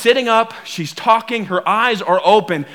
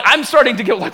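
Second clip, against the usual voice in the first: ordinary talk, nothing marked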